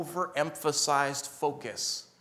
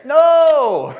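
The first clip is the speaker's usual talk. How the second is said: emphatic no; 10 semitones higher, 14 dB louder than usual. Real, not acted. Rejects missionary idea.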